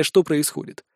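plain reading voice

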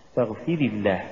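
ordinary talk

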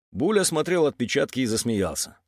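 plain reading voice

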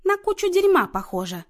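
high, loud and pleased; gleeful announcement. A narrator's reading. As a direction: proclaim it joyfully to everyone. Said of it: "радостно возвестила Люся".

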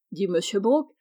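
ordinary delivery

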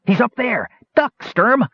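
very high, urgent cry; shouted warning. Acted. Gnome on the wall warning the knight below, shouting high. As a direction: shout a warning with pitch way up, not much volume. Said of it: "Wingover shouted".

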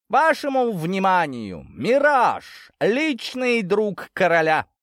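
loud, high, slow herald's announcement; booming herald. Acted. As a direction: proclaim it across the throne room. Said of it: "зычный голос Герольда".

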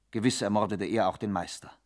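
ordinary delivery

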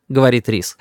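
ordinary delivery

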